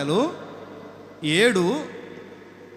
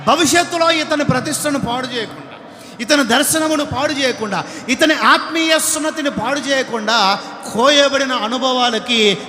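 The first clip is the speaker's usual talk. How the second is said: higher and louder, ending in shouts; full-force preaching; 4 semitones higher, 5 dB louder than usual. Acted. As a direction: hammer each sin, then cry out. Preaching continues at volume.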